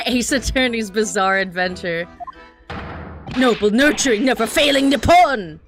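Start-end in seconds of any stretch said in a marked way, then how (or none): 3.4-5.6 loud, rousing patriotic declaration; grand proclamation. Acted. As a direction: proclaim it to the heavens. Prisoner proclaims.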